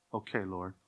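soft, submissive reply to the Lord; meek believer. Acted. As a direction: answer God quietly like a scolded child. Believer answering God in acted scene.

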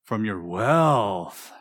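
"from your" kept low, "wealth" stretched out and rising-falling; stress on WEALTH. Shown as a demonstration. Preacher offering the congregation the third reading choice, stress on "wealth". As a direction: hold back, then draw out "wealth" with weight. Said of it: "my own oral reading version".

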